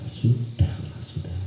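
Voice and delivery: unvoiced hush to calm him; a whispered never mind to the sulking novice; whispered soothing. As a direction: breathe it out like settling a child.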